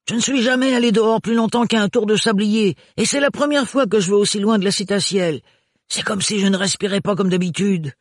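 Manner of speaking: bubbling over inside; Renard's voice. Acted. Renard telling Ophélie he's thrilled by the journey.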